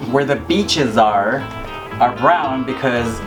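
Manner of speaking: ordinary delivery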